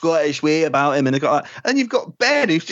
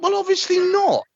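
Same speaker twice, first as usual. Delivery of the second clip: high sarcastic companion. Retold or imitated. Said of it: "It's like".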